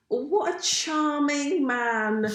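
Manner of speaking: slow, high, lofty drawl; lightly mock a grand royal; posh royal impression. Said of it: "and it's like".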